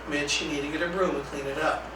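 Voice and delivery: quiet, even, unhurried instruction; very calm voice. Shown as a demonstration. Staff redirecting a challenging student, calm and quiet. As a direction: calmly repeat the directive without rising to it. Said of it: "in that very calm voice".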